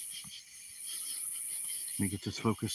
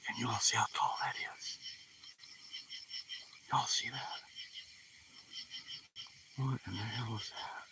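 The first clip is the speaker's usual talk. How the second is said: whispering; 13 dB quieter than usual. Real, not acted. Night filming, hushed to the viewers.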